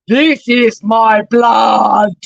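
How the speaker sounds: slow, loud vampire intoning; Count Dracula. Retold or imitated. Priest at the altar as Dracula, slow and loud. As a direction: boom it out like Dracula. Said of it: "like the count".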